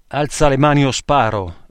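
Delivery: frantic policeman bellowing an order; furious shout. Acted. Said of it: "sbraitò il forsennato".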